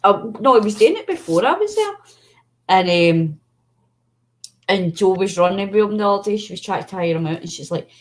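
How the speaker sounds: ordinary delivery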